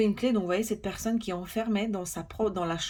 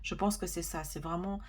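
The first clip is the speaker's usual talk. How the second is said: ordinary talk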